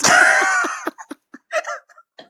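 loud high burst of laughter. Real, not acted. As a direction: roar with laughter. Big laugh at joke.

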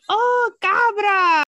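high, loud, slow "Oh, cabra"; light up as you get it; sudden realization. Retold or imitated. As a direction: Brazilian husband suddenly getting it, delighted. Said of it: "And he goes".

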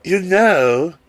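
high, stretched camp voice; lightly camped-up, pitched high. Retold or imitated.

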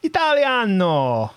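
put-on drawn-out Italian-accented Italiano; mock Italian. To mates asking about Italians, a slow mock Italian Italiano. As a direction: stretch it slowly like a proud Italian.

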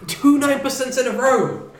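surprised. Real, not acted. Learning next beer is strong.

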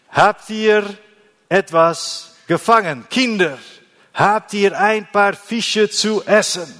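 high, loud call across the water. Acted. Jesus calling from the shore to the disciples, loud high call. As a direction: shout it out warmly to the boat. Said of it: "rief er ihnen zu".

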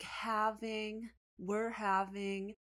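slow, puzzled read-out, stretching each word; slowly deciphering aloud; reading piece by piece. Retold or imitated. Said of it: "starts scratching in the middle".

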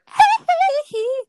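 very high, loud "hee-hee" yelp. Acted. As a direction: squeal like a pop star. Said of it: "went [name] mode".

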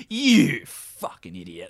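slow, low mock disgust; disapproving. Imitating the boyfriend looking down at her, slow disapproval. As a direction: draw it out low, shaking your head. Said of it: "shaking his head like".

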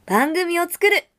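sudden jump in volume on the last phrase. Acted. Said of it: "声が大きくなってるから".

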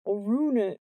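speech thickened by a swollen mouth; thick-lipped speech. A narrator's reading. Jordan with bloody mouth to Sarah, thick swollen-lipped voice. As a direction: speak through big, puffy lips. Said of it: "he said thickly through his enormous lips".

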